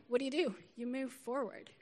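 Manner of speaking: usual speaking voice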